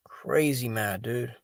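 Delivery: breathy whispered sigh, slow. Real, not acted. Streamer to chat, sighing in a slow whisper. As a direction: sigh the words out under your breath. Sighs, tired late-night stream.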